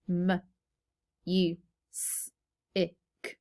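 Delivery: each sound of M, U, S, I, K said separately with pauses; phonetic sounding out. Shown as a demonstration. Teacher sounding out music for pupils, slow phonetic segmenting. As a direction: sound it out slowly, piece by piece. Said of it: "Now let's sound this out".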